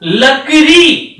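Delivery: लकड़ी said very slowly, leaning on the final long ड़ी; drawn-out stressed demo. Shown as a demonstration. Teacher shows the long ई in लकड़ी: very slow and stressed. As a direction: stretch लकड़ी, dwelling on the long ई. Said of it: "जोर देते हैं".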